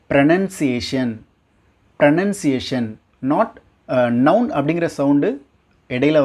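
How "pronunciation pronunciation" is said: pronunciation as pro-NUN-see-ay-shun; correct pronunciation. Shown as a demonstration. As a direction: put nun inside pronunciation. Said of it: "உண்மையான pronunciation என்ன".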